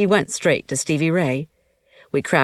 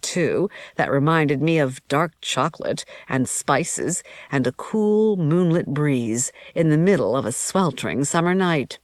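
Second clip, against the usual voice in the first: usual speaking voice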